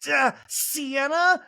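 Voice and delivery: high, slow mock-clueless guess; Shannon put on the spot. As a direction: drag it out high, as if unsure of the answer. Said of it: "Shannon was like".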